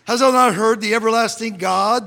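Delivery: ordinary talk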